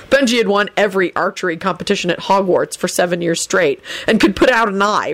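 ordinary delivery, nothing marked

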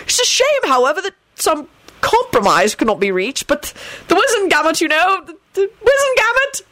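high, flustered minister; Fudge. Acted. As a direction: dither in a high, fussy official's voice.

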